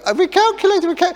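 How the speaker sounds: muffled, very high voice of a sat nav stuck in the boot; trapped sat nav. Retold or imitated. Dad voicing the sat nav shut in the boot, pleading, very high squeak. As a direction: squeak it out like a machine buried under luggage. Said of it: "She sounds like she's trying to escape".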